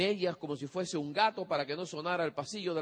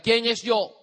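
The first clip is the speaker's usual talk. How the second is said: loud, sharp, higher demand; father at the door, loud and stern; 5 semitones higher, 8 dB louder, slower than usual. Retold or imitated. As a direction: challenge the son loudly. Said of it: "Dice el padre".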